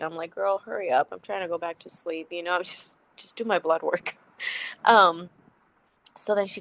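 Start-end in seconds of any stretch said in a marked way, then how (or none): none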